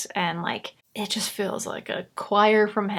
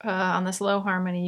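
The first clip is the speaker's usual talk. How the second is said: ordinary talk, nothing marked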